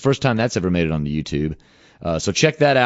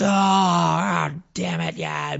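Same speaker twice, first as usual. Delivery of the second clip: a high groan that drops to a grumble; mock a crowd's disgusted groan; imitating the fans groaning over the loss; imitating angry fans; 9 semitones higher, much slower than usual. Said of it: "We can hear the crowd just".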